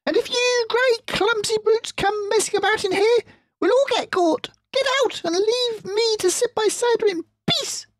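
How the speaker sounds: very high, loud squealing rat; shrieking Rat. Acted. Rat shrieks at fox and badger for poaching. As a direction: shriek it in outrage.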